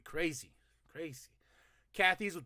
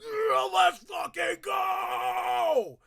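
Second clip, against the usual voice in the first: high, loud, slow cheer of joy; excited shout; 12 semitones higher, 7 dB louder, much slower than usual. Real, not acted. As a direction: jump up and yell it. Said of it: "I jumped up".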